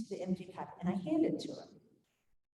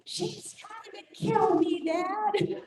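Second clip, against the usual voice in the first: high, drawn-out tattling mock scream; imitated child's scream; 12 semitones higher, much slower than usual. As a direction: wail to dad like an accusing kid. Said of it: "C J screams from the".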